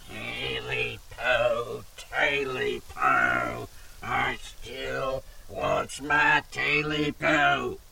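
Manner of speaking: very slow, drawn-out eerie wail; lone creature voice. A narrator's reading. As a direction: stretch every word like a voice drifting through trees. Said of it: "a lone voice came through the trees".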